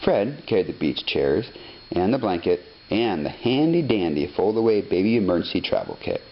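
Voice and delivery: ordinary delivery, nothing marked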